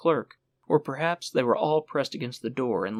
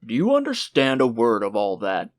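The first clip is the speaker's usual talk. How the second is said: stern, louder clerk voice; exasperated official; 6 dB louder than usual. Acted. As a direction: demand it of the parents. Chief clerk asks the parents.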